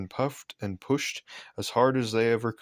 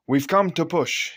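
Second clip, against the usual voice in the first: cheerful driver; 7 semitones higher, 5 dB louder than usual. A narrator's reading. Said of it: "he said".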